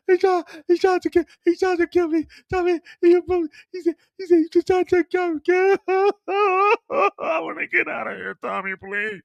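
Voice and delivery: very high, stammering falsetto panic; hysterical woman. Acted. Mireya hysterical, attacked.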